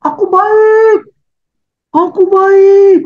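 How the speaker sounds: high, loud, slow call across the valley; child shouting. Acted. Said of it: "dia teriaklah di lembah itu". (anak berteriak keras di lembah)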